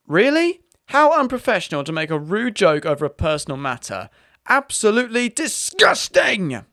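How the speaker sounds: high, huffy mock-offended customer; outraged complainer. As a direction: ham up a scandalised complainer, pitched well up. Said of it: "then the one who made the complaint says".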